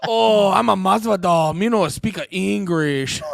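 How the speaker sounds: loud, slow, hammed-up foreign accent; Masvidal, bad mock accent. Podcaster retrying Masvidal's answer to the cops, hammed-up accent. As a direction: belt it out in a badly faked accent, stretching the words. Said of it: "I'm not good at 'em".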